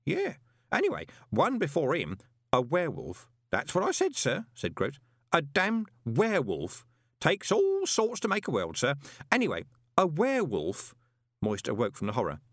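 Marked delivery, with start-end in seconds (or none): 0.1-10.9 high-pitched gossipy underling; lively, high character voice; chatty old postman Grote. Acted. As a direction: relay grim gossip with relish, pitched well up.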